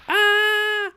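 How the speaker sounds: high, sudden yelp of fright; startled scream. Acted. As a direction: shriek as the tiger pounces. Nick attacked by tiger.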